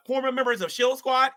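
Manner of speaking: ordinary delivery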